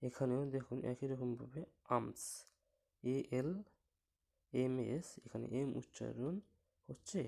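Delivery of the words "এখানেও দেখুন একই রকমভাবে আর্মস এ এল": normal talking voice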